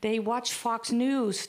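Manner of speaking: ordinary talk, nothing marked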